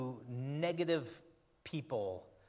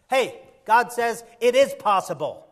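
loud, raised, urgent sermon voice; full-force preaching; 6 semitones higher, 11 dB louder than usual. Real, not acted. Preacher to church about facing the new year, full-force preaching. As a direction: preach it out hard to the congregation.

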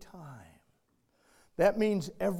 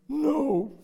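put-on whiny child; 8 semitones higher, 7 dB louder than usual. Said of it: "child answering".

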